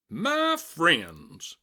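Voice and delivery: a salesman calling out high and loud to the crowd; clear, keen announcing. A narrator's reading. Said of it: "he announced in a voice clear and keen".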